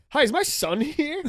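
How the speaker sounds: high, slow mock polite mom voice; a high, sugary mom voice; imitating mom. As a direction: play the sweet mom asking politely. Mom voiced in retold story.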